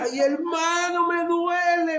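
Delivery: hammed-up grief; crying out in mock pain; imitating a sobbing man. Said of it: "Me decía".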